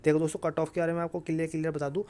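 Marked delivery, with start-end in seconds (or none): none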